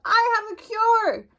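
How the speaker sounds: high, slow call upward; shouting up. Acted. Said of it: "The duck shouted up".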